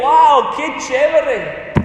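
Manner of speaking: high, drawn-out gush; mock amazement. Preacher mimicking what Isaiah does not say, mock amazed. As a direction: gush as if wowed by a show.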